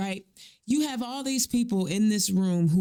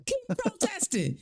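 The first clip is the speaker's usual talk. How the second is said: mock encouragement; 12 semitones higher, 3 dB quieter than usual. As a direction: imitate a hollow cheerleader up in a high pitch. Mocking promise made to Iranians.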